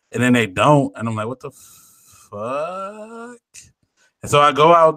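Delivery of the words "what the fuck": a long, breathy, incredulous what the fuck; drawn-out disbelief. Retold or imitated. Retelling the Walmart wait, a slow stunned disbelief. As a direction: stretch it out, hushed and baffled. Retelling his confusion waiting for keys.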